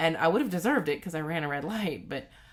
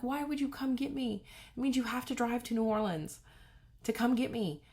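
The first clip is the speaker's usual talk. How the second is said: upset and tearful; 10 semitones higher than usual. Retold or imitated. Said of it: "I'm just sobbing uncontrollably".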